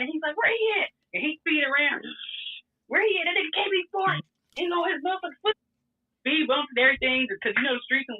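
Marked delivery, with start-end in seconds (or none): 0.3-0.9 higher, angry demand from the jealous man; mock his hot-headed yelling; mad boyfriend. The boyfriend, angry about James, demanding. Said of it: "And then he get mad". 2.9-5.5 higher, angry demand from the jealous man; mock his hot-headed yelling; mad boyfriend. The boyfriend, angry about James, demanding. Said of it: "And then he get mad".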